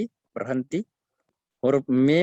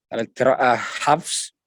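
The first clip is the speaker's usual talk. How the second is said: normal talking voice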